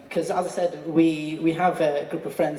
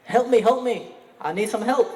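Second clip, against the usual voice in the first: higher-pitched, hammed-up plea for help; mildly acted panic, voice raised in pitch; mock cry for help; 7 semitones higher than usual. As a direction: imitate a victim begging for help. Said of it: "he said".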